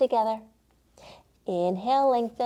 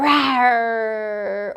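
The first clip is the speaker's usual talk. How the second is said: put-on playful roaring growl for the kids; lion roar; 3 dB louder than usual. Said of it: "Let me hear your roar".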